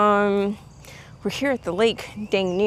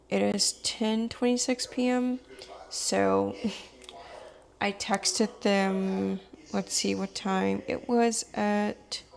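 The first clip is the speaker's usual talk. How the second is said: softer hushed late-night talk; quiet; 8 dB quieter than usual. Real, not acted. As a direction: keep it down, people nearby. Talking late at night in the dark.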